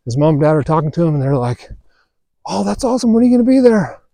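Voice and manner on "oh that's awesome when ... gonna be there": proud mom. Retold or imitated. Parents react to son's news.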